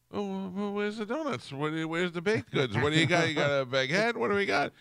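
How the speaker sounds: high-pitched demanding whine; high mock demand. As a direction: squeal it out like a hungry kid. Mock demanding quote to Bobby.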